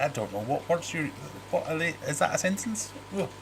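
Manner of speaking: high-pitched bewildered questioning; baffled. Retold or imitated. Dad asks teenage son what he said, baffled. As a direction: stumble through it in high-pitched confusion. Acting out bafflement at son's speech.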